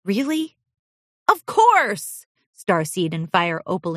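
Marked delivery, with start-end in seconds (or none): 0.0-0.6 higher hopeful question; amazed filly. Acted. Olivine amazed. 1.3-2.2 much higher, louder cheer; eager reassurance. Acted. Friends assure.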